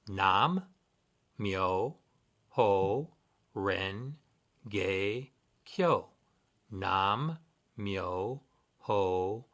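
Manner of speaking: Nam, Myo, Ho, Ren, Ge, Kyo, each drawn out and set apart by pauses; syllable-by-syllable pronunciation. Shown as a demonstration. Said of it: "teach you the pronunciation".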